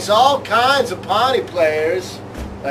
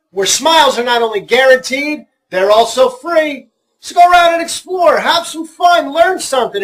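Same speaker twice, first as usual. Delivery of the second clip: upbeat mock commercial voice; ad read; 3 semitones higher, 3 dB louder than usual. Co-host reading a fake commercial, upbeat ad voice. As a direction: sell it like a cheesy ad. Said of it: "we got a commercial for you".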